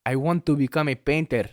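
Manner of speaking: hammed-up exotic European accent, earnest son; lightly put-on European accent pleading with father; mock heavy accent. Son tells his father he wants to paint, in a put-on European accent. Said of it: "Everyone else is like".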